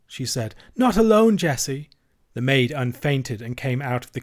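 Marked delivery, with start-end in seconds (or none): 0.7-1.9 high, loud, urgent insistence; alarmed wife. A narrator's reading. Vicar's wife refusing to let him go, alarmed. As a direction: insist anxiously, then call the maid. Frightened wife orders maid.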